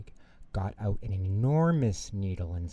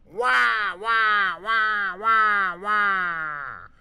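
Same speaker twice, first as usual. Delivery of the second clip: put-on high, loud screaming baby; 12 semitones higher, 5 dB louder, much slower than usual. A narrator's reading. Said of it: "It screamed really loudly".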